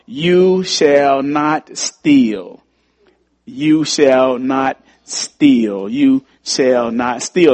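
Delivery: slow, weighty reading of the verse; solemn scripture. Acted. Preacher reads the commandment to his congregation, slow and solemn. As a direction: let each word land with gravity.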